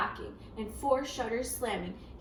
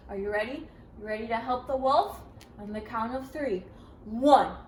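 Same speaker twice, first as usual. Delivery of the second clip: plain reading voice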